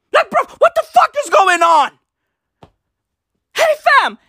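high, fast exasperated disbelief; baffled outburst. Real, not acted. Venting to viewers about state of disaster, baffled outburst. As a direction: burst out in confusion.

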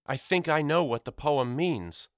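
a mother urging her sons; mother calling out. Acted. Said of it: "she shouted".

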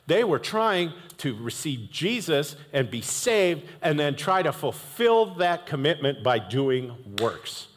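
normal talking voice

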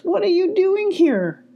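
high-pitched questioning call. A narrator's reading. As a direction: call the question across a meadow. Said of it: "called Rose".

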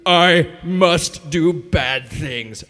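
very slow, sinister little creature voice; voicing the evil tongue inside us for the youth group, slow and sinister; evil little thing. Slow creepy gremlin voice. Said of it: "this evil little thing inside of us".

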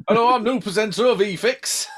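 put-on comic northern English accent in a presenter's hello. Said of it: "why was he northern".

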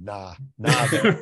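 a lightly voiced dismissive ex-Laker, nah drawn out; imitating J R Rider. A touch of J R Rider waving off the writer at his door. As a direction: brush off the writer like J R did. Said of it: "When I imitate him".

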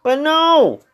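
normal talking voice

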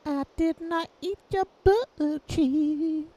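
very high, slow cartoon voice; high silly voice. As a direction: squeak it out slowly. High goofy voice bit.

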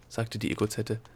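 ordinary delivery, nothing marked

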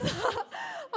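squeaky laughing fit; high squealing laugh. Real, not acted.

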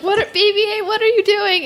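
high-pitched, bewildered outcry; startled mock alarm. Fan voicing the heroes watching BB-8 transform, startled mock alarm.

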